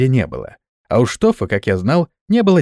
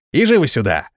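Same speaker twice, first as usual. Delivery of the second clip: urgent hurry-up call, moderately raised; yelled command; 3 semitones higher than usual. A narrator's reading. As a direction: order them over with a restrained yell. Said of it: "заорал Рострум". (сдержанный командный окрик)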